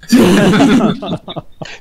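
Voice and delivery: breathy unvoiced giggle; whispered laugh. Real, not acted. Laughs at accent joke.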